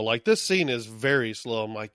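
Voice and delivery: ordinary talk, nothing marked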